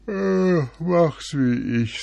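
high, loud drowsy badger; a lazy badger yawning; sleepy badger. A narrator's reading. Badger voiced.

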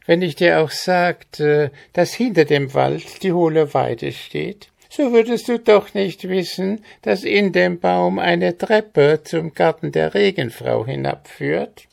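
high squawking goblin voice full of scorn; schnarrender Kobold. Acted. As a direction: sneer down at the simple farm lad as a goblin. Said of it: "fuhr der Kobold fort". (hohe, höhnisch quäkende Koboldstimme)

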